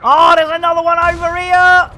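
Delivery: loud, high battle cry; worked-up gamer gloating; triumphant taunting shout. Real, not acted. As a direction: yell it in the enemy's face. Taunting a beaten game enemy.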